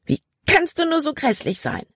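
exploding outburst. A narrator's reading. Girl explodes at the smug boy who rescued her, outraged. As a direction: burst out at him in outrage. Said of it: "explodierte dann".

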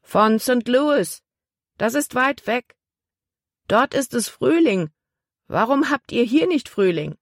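high, projected storybook call; calling back. A narrator's reading. Ship's boy answering a girl on shore, calling back. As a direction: pitch it up as if answering across the water. Answering a shouted question from deck.

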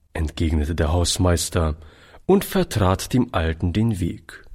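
usual speaking voice

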